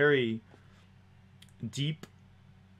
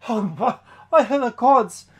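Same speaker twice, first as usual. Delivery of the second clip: high, loud gasp of alarm; startled recoil; 11 semitones higher, 12 dB louder than usual. Acted. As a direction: cry out as if recoiling from a monster. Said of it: "she sort of recoils".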